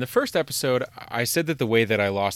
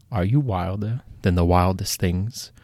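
ordinary delivery

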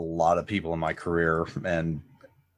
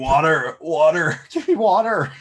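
mock plea for water; 8 semitones higher, 5 dB louder than usual.

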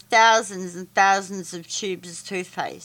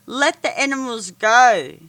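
firm demand; 3 semitones higher, 7 dB louder than usual. A narrator's reading. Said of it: "I said".

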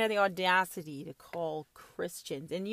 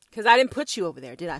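normal talking voice

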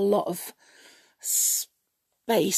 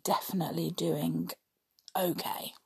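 whispering; 2 semitones lower, 8 dB quieter than usual. Real, not acted.

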